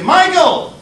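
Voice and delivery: loud, high scolding shout of the name; teacher's sharp call. Retold or imitated. Teacher snapping a daydreaming pupil to attention, loud. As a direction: call him back from the window.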